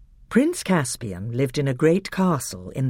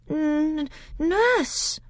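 put-on higher, broken frightened boy; faltering. A narrator's reading. Caspian answers the angry king, faltering and higher. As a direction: stammer it out in fear. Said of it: "faltered Caspian".

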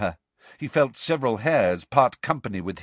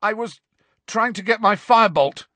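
croaked; 12 semitones higher, 6 dB louder than usual. Acted. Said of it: "Harry croaked".

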